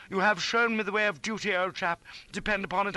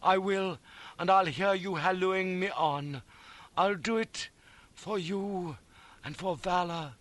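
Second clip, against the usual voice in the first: high, slow fervent tenderness; slow, emotional promise with solemn pauses; noble farewell; 6 semitones higher, slower than usual. A narrator's reading. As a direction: vow earnestly, swelling with heroic feeling. Noble farewell to the injured friend.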